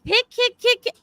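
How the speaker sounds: sing-song high child imitation; retelling the girl chanting kick at the baby; high kid voice. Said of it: "Holding his feet".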